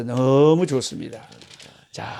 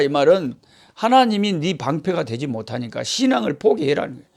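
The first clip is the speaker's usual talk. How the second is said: ordinary talk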